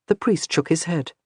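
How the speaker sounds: plain reading voice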